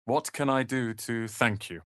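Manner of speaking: ordinary delivery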